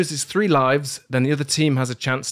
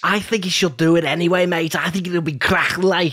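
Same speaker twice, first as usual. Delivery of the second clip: quick, put-on Scouse accent; hammed-up Scouse; mock Scouse accent; 3 semitones higher than usual. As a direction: egg them on in a fake Scouse accent. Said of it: "I would do my Scouse accent".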